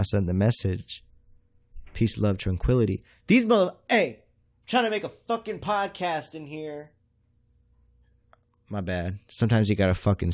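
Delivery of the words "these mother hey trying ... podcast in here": high-pitched yell away from the mic; irritated holler, pitched way up; real scream. Real, not acted. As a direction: scream at people to cut out the interruption. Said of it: "you gotta scream".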